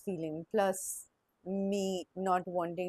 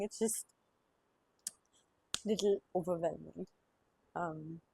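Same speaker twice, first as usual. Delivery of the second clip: crying; 2 semitones lower, 4 dB quieter than usual. Real, not acted. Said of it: "sorry, I'm crying".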